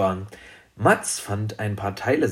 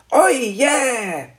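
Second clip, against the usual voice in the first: high, delighted little dragon; begeisterter kleiner Drache; 13 semitones higher than usual. Acted. Dragon delighted by cocoa offer. (hohe, begeisterte Drachenstimme)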